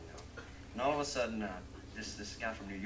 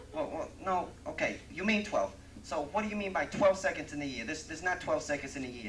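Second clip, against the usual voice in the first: higher, louder, puzzled city guy; baffled New Yorker; 6 semitones higher, 7 dB louder than usual. Acted. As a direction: argue back, confused. New Yorker baffled, argues back.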